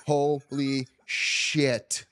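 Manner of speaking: very slow, drawn-out retold disbelief; stunned. Husband reliving the labor starting, stunned and drawn out. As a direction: stretch out each word in mock shock.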